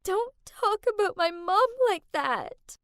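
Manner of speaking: hurt and crying, begging her to stop; sobbing. Acted. Kath defending her mom while sobbing.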